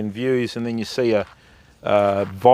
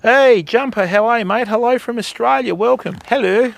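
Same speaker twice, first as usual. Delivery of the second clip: excited greeting; 10 semitones higher, 4 dB louder than usual. Real, not acted. Greeting a chat viewer warmly.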